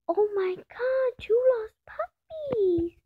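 high, slow delighted squeal; thrilled at the find, drawn out high; excited. Real, not acted. Player finds two lost puppies, squealing with delight.

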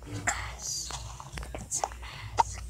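soft secret whisper. Pretend. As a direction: lean in and whisper it. Secretive aside.

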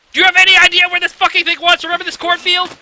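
high, loud, fast furious question; exasperated yell. Real, not acted. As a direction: blow up at the viewer in disbelief. Repeated question, louder, exasperated.

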